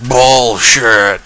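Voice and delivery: loud, stretched-out protest; drawn-out mock outcry. Retelling a man shot in the belly, drawn-out mock cry. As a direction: drag the word out, hammed-up.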